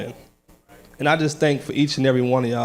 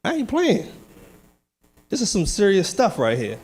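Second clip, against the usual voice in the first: emphatic insistence; 6 semitones higher than usual. Real, not acted. Insisting to congregation, serious declaration.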